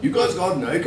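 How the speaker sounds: slightly high imitated Irish accent. Storyteller quoting the Irish guy's request, mimicked Irish accent. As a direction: mimic an eager Irishman asking. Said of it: "talking in this Irish accent".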